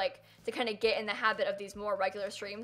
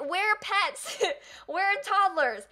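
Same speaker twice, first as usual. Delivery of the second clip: high, mocking demands; mock demanding fan; 9 semitones higher, slower than usual. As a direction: whine it like an impatient fan. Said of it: "everyone's just like".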